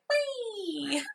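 put-on high, squealing baby. Voicing the zombie baby.

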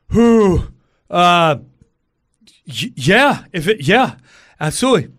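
high, loud, stammering yes; startled fan jumping at the offer; flustered excitement. Retold or imitated. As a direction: stumble over an eager, startled yes. Stunned by offer to meet Billy.

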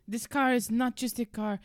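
normal talking voice

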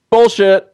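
bullshit punched high and loud; emphatic bullshit. Real, not acted. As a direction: land bullshit hard as the payoff. Punchline after building list.

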